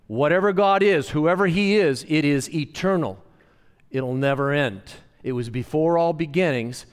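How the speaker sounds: forceful preaching, easing slightly as it builds the list; emphatic preaching. Real, not acted. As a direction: proclaim it to the congregation, high and loud at first.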